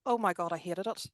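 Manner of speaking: ordinary delivery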